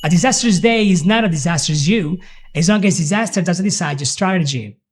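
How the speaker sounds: A disastrous day rattled off quickly; fast native speed. Shown as a demonstration. Teacher showing the phrase at native speed. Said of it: "at full speed practice".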